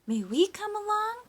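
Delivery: high, pleading little fish voice; high squeaky plea. A narrator's reading. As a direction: beg to tag along in a squeaky voice. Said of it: "pleaded the fish".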